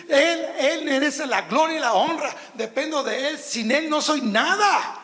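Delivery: high, loud preaching build; rising sermon fervor; fervent preaching. Acted. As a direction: lift it up with fervor, full voice. Preaching at full force.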